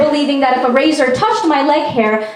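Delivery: ordinary delivery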